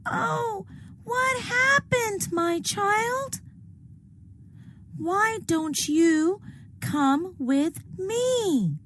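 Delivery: very high motherly owl; a caring owl calling the child home; high owl voice, slowing at the end. Acted.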